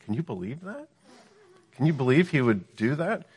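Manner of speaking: incredulous insinuation. Acted. Devil insinuating to the woman against God, incredulous. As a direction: plant doubt as if scandalised. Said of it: "some of the emphasis there?".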